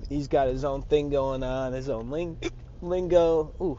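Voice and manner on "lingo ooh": hiccuping mid-word; a sudden hiccup breaks through. Real, not acted. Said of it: "giving me the hiccups".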